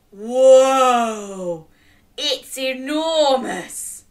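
loud whoa, slow enormous; amazed. Shown as a demonstration. Modelling the line for children, awed. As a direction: show awe at its size. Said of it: "Can you say".

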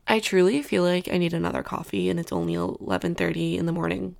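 ordinary talk

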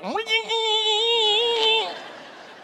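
a long high burst of laughter. Real, not acted. Pastor laughing at the teenagers, high and long.

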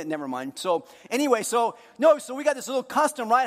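normal talking voice

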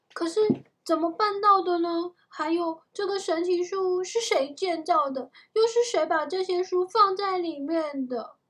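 high, loud string of puzzled questions; baffled questioning. A narrator's reading. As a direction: demand answers as an astonished boy. Jack fires baffled questions.

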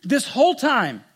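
elder son accusing father, furious; angry son. Acted. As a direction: throw every grievance at the father. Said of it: "He's angry".

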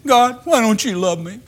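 high, mock whining plea; whiny complaint. Preacher imitating a believer whining to God, high and mocking. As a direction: whine at God like a sulking child. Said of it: "going to God and saying".

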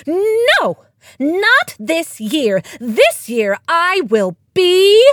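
high, loud, drawn-out howl of refusal; howling defiance. A narrator's reading. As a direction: howl it out slowly, stretching the words. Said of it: "before howling".

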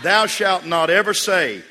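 slow, loud, high proclamation in jest; playfully solemn decree; mock commandment. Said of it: "Here's the eleventh commandment".

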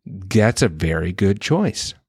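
put-on high-pitched praise for a little kid; parent's singsong coaxing, pitched well up; parent praising child. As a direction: imitate encouraging a small daughter. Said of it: "and I'm like".

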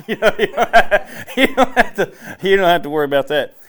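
laughing loudly through the words. Real, not acted. Laughing at own wire joke.

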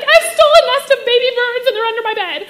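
high, loud blurted confession, half laughing; panicked confession. Retold or imitated. A five-year-old admitting the stolen birds to his mom, in a mock panic. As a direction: spill the secret in a hammed-up panic. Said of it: "I, like, start to panic".

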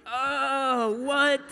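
high, loud startled exclamation; surprised. Retold or imitated. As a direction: jump up in pitch, caught off guard. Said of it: "And I was like".

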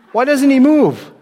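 high, loud incredulous question; loud, high-pitched bafflement; baffled neighbor. Acted. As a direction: exclaim it in disbelief. Acted neighbor in a joke, baffled.

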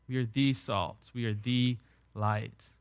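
slow and soft, with "the" as a full "thee"; stress on THE. Shown as a demonstration. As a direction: let "the" carry the weight, quietly and slowly. Repeats the emphatic article, softly.

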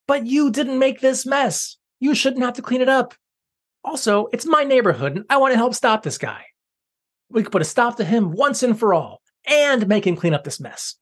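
put-on high eager young girl voice; girl character voice. Sarah pleading, high voice.

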